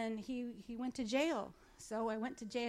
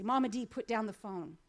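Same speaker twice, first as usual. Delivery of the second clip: louder, mock-insistent instruction; retold exasperated order; 9 dB louder than usual. Inmate telling his visitor to put down the phone, retold louder. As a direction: raise the voice as if telling someone off.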